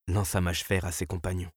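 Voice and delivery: usual speaking voice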